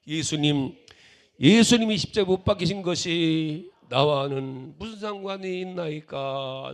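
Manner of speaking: loud, impassioned cry with a drawn-out ending; full-force plea, slowing at the end. Retold or imitated. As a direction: shout the question to heaven. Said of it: "세 번째 부르짖다가".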